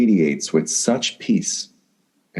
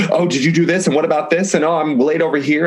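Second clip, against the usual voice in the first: higher-pitched mock fluster; hurried fussing in a raised, imitated voice; 8 semitones higher than usual. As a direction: imitate someone frantically running late. Acting out frantic morning greetings.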